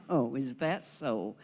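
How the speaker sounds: slow, low, sceptical housewife on the phone; lightly played doubt, drawn out. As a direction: draw it out like an unconvinced customer. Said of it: "I'm a housewife".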